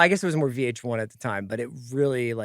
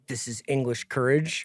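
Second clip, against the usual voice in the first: flat fake English accent; 3 semitones lower, 7 dB quieter than usual. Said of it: "he's like".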